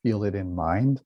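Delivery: calm, measured meditation voice with long pauses; guided meditation. Acted. Teacher guiding listeners to relax and feel a smile, calm meditation voice. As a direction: guide listeners gently inward.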